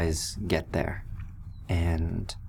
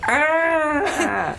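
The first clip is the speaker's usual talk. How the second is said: high loud fake yelp; mock startled yelp; 5 dB louder than usual. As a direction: yelp as if the shove hurt. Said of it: "He goes".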